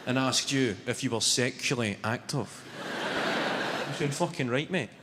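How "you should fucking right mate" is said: normal talking voice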